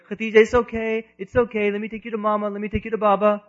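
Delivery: high, sing-song little brother's reassurance; imitated consoling child. Boy hugs the crying sister he pushed, imitated consoling. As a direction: soothe her in a high child's voice. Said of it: "he goes and hugs her".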